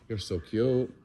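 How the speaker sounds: ordinary talk, nothing marked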